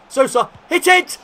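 very high, loud command to shoot; urgent shout. Real, not acted. Urging his striker to shoot, very high excited shout. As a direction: scream at him to shoot.

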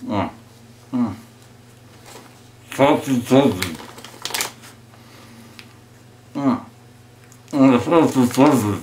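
mumbling through stuffed cheeks; words mushed by a full mouth. Real, not acted. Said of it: "stuff as many marshmallows into your mouth".

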